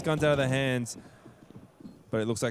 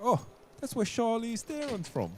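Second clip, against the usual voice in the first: high, soft put-on movie voice; quoting a movie line; 9 semitones higher, 5 dB quieter than usual. Caster showing his partner where the quote comes from, high movie-quote voice. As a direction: do the film character's line high and quiet. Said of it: "it's from a movie where they're like".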